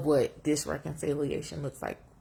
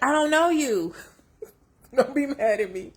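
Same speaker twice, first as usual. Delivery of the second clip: much higher, louder blunt retort; teen pushback; 8 semitones higher, 6 dB louder than usual. Retold or imitated. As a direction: fire it back like a defiant teen. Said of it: "But I'm like".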